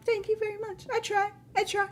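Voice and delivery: tiny high, soft voice; small, high-pitched modest squeak; bashful thanks. Real, not acted. Streamer accepting a viewer's compliment, bashful.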